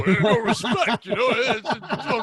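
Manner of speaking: loud, high comic complaint; Rodney Dangerfield. Acted. Botching a Stallone impression, loud mock Italian. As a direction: drag it out as a hammed-up grievance. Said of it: "It's supposed to be Stallone, but I just go".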